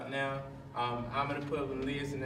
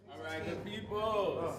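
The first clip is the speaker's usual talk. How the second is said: slow, raised voice to gather people; calling the group; 6 semitones higher, slower than usual. Real, not acted. Host gathers the poets.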